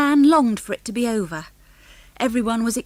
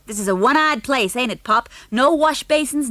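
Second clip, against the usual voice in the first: put-on rude American boy; 5 semitones higher, 5 dB louder than usual. Junior complaining rudely.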